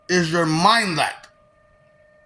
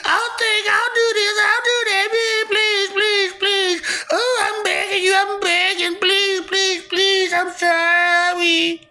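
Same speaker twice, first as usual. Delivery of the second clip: high, wailing mock plea; mock begging; 11 semitones higher than usual. Mimicking the sufferer begging to be spared, mock wailing. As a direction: whimper and plead in a high crybaby voice. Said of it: "The suffering kicks in. And it's like".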